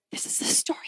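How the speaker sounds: hushed breathless whisper, leaning in; a child whispering in awe; awed whisper. Retold or imitated. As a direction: whisper it like a secret you just recognized. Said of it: "on the edge of my seat going".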